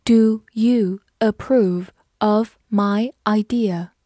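very slow, word by word; slow reading. Shown as a demonstration. Teacher asking if you approve, read slowly for learners. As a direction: space out each word of the question. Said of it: "慢慢来读".

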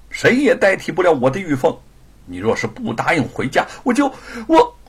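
rising to a loud cry; desperate husband. Acted. Husband threatens to kill himself, desperate cry. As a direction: choke off the threat.

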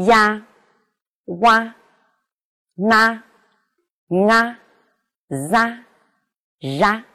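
呀, 哇, 那 and ra each said clearly and separately; one careful syllable at a time; variants of a. Shown as a demonstration. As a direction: give each variant of 啊 on its own, distinctly. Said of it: "分别读作".

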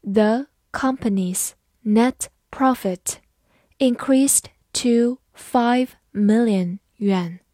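slow, word-by-word model sentence; measured read-along pace for learners. Shown as a demonstration. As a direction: read slowly with a gap after each word. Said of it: "慢读一遍".

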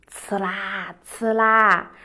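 slow, low, drawn-out scratching sound; imitated scratching noise. Rabbit scratching the trunk to fool hawk, imitated scratching. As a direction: voice the scratching in two long strokes.

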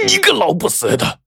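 high-pitched exasperated curse; enraged. A narrator's reading. Robber cursing the woman who bit his hand, enraged. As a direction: curse her in a strained fury. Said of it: "气急败坏的周强".

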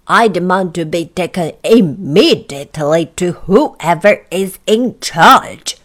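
loud, indignant demand; angry. Acted. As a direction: demand it angrily, leaning on each word. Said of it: "said Mister Uppity angrily".

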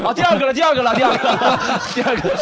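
high, loud mock eunuch voice; hammed-up palace eunuch whine. Shown as a demonstration. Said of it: "这样说我是那个太监".

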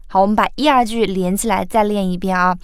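ordinary delivery

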